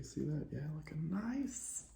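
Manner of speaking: soft, slow, playful whisper; teasing whisper. Real, not acted. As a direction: whisper it like a sly aside. Teasing about the new dude.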